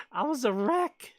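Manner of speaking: giggling confession of being a wreck; laughing it out. Real, not acted. Laughing at her own wreck.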